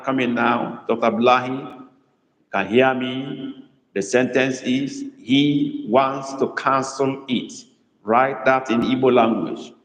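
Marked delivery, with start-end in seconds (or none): 5.2-7.5 slow dictation. Shown as a demonstration. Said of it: "Emphasis is on to cancel".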